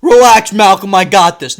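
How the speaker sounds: loud, brushing her off; dismissive dad. Retold or imitated. Dad to the teacher, loud dismissive brush-off. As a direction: wave the teacher away, loudly. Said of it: "That's what he says".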